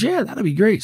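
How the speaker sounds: high, loud, eager agreement; naive eagerness. Retold or imitated. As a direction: imitate a gullible kid jumping at it. Kid eagerly agrees, retold.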